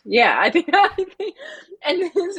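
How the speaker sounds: breaks into a high laugh mid-sentence; giggling, words tumbling out through the laugh. Real, not acted. Laughing while recalling her first rating.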